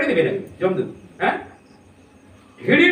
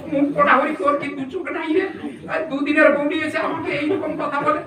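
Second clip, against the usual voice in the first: put-on high, fast aggrieved old-woman voice; a mother-in-law railing at Hari in the temple; wailing mother-in-law; 5 semitones higher than usual. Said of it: "mother-in-law complaining at temple".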